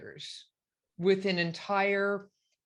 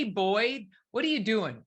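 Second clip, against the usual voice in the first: ordinary talk, nothing marked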